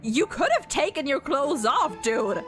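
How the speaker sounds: ordinary delivery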